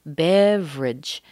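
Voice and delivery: "beverage" slow, as BEV-ridge; missing syllable. Shown as a demonstration. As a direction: drop the second e of "beverage", drawn out. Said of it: "we're missing another syllable".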